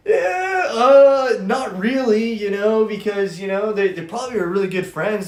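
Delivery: put-on high, loud, naive teenage voice; innocent younger self. Making excuses for his girlfriend to a buddy, naively innocent. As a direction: play your clueless younger self, pitched right up. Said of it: "I'm so innocent".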